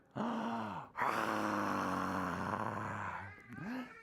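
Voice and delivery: big gasp, then a long breathy roar; dragon roar. Pretend. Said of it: "We're dragons with breathing fire".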